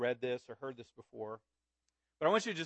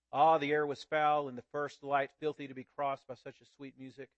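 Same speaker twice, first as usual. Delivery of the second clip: earnest storyteller reading aloud to the congregation; story narration; 5 dB louder than usual. A narrator's reading. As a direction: read it like a tale told to a child. Preacher reads Wangerin story aloud.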